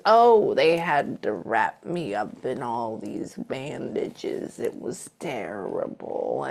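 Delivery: a spirit's slow, low, measured voice; methodical and slow. Retold or imitated. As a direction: medium voicing the late mother, slow and deliberate. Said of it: "She talks very, um, methodical and slow".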